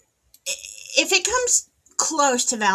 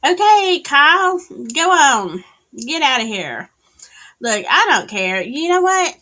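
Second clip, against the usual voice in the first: loud playful shooing; 3 semitones higher, 8 dB louder than usual. Real, not acted. Loudly shooing off a chatter.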